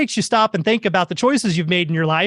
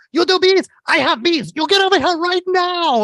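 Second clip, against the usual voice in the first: imitating screaming neighbor; 9 semitones higher than usual. Neighbor lady screaming at the bee remover, frantic high yell. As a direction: shriek it like a panicked neighbor. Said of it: "she starts screaming at me".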